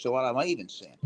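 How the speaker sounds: usual speaking voice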